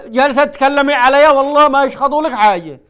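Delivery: loud mock rebuke; loud playful telling-off. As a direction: raise your voice at her, half in jest. Host scolding caller loudly.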